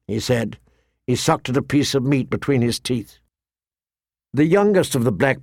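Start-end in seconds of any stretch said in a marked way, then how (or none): none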